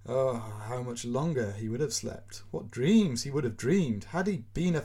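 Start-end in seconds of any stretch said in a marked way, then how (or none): none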